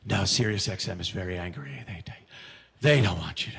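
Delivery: low, grave agent voice; imitating his agent. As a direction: drop the voice low like the worried agent. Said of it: "and he's like".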